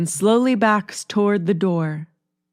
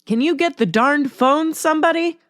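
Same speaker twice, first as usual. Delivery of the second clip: higher, irritated shout-out; annoyed call; 9 semitones higher than usual. A narrator's reading. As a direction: call out crossly from the recliner, pitched up. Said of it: "gets angry".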